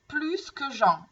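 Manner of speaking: plus said with a sounded final S, "plyss"; S pronounced in plus. Shown as a demonstration. Teacher showing learners a comparative, final S of plus pronounced. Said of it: "je prononce le S".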